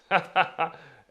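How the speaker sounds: high, loud chuckle; short laugh. Real, not acted. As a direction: laugh it off in a quick burst. Laughs off the rejection.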